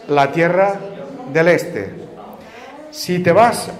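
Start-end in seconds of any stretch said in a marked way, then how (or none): none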